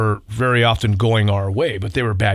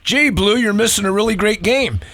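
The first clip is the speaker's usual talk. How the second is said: much higher, sly heckle thrown toward the umpire; ventriloquist heckle; 10 semitones higher than usual. Retold or imitated. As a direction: tease the ump in a higher mock voice from the dugout. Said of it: "throw your voice like a ventriloquist".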